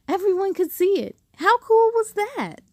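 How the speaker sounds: high, fast gushing; high thrilled exclamation; excited. A narrator's reading. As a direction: rave about it. Narrator gushing.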